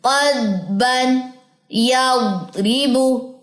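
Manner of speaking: "यज़रिबु" stretched smoothly; correct slow reading. Shown as a demonstration. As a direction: model the proper pronunciation. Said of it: "ये सही pronunciation".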